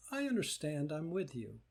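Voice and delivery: soft, tender consoling; soothing the hurt part. Shown as a demonstration. Teacher soothing her own hurt part, soft and tender. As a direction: comfort a hurting child gently. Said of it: "You ask this one".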